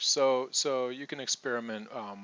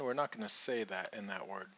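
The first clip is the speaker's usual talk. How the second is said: usual speaking voice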